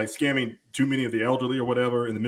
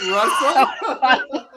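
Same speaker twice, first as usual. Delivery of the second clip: laughing mock confusion; 10 semitones higher, 5 dB louder than usual. Mimicking a confused caller, laughing.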